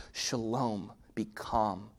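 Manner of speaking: ordinary delivery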